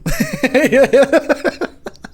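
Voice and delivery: high laugh. Real, not acted.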